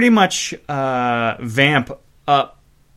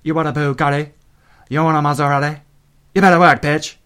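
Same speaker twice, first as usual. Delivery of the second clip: hammed-up bad British accent imitation; mock-British pop lyric, landing harder at the end; terrible British accent; 2 semitones higher than usual. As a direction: put on an obviously fake British accent. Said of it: "transparently terrible British accent".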